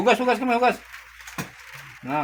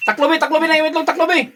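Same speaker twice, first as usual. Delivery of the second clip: urgent loud call; 13 semitones higher, 11 dB louder than usual. Real, not acted. Urging cover while brushing.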